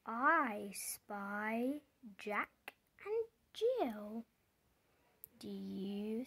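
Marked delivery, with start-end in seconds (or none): none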